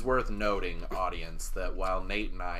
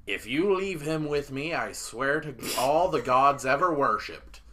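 raised, higher captain's voice, swearing an oath; exasperated captain; 6 semitones higher, 7 dB louder than usual. Acted. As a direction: threaten loudly as the fed-up captain. Captain threatens, swearing by gods.

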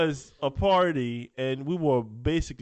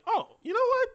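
high, hammed-up gushing impression; teasing impression of his buddy, pitched way up; mock co-host. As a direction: squeal it like a friend vouching excitedly. Imitating co-host's verdict.

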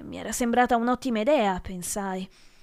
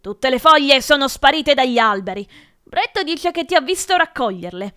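loud accusing tirade, rising in pitch; shouting; 4 semitones higher, 8 dB louder than usual. A narrator's reading. Professor accusing the pupil of taking leaves, shouting. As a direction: lay out the charge at full voice. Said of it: "urlò il professor Prurity".

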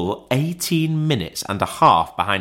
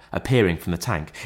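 ordinary delivery, nothing marked

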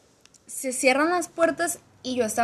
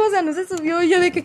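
normal talking voice

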